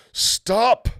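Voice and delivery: high, loud imperious stop; commanding queen. Acted. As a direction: halt the sledge with one command. Queen halting the sledge.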